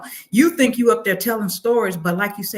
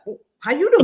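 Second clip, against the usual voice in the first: friendly greeting; 7 semitones higher, 4 dB louder than usual. Retold or imitated. Mimics his greeting again, bigger.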